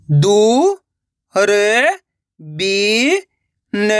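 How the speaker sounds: drawn-out syllables, clearly separated. Shown as a demonstration. Teacher spelling out a word for learners, syllable by syllable. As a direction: sound it out piece by piece. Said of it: "एक एक अक्षर का उच्चारण करके".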